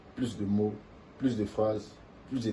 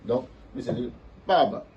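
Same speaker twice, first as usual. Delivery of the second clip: ordinary talk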